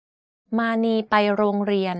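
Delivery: "มานีไปโรงเรียน" in one constant pitch; middle tone on "มานีไปโรงเรียน". Shown as a demonstration. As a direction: keep every syllable of "มานีไปโรงเรียน" level, at normal pitch. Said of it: "All of these are in constant pitch".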